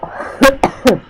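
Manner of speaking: harsh cough from spicy heat; chilli cough. Real, not acted. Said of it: "I'm going to cough in a minute".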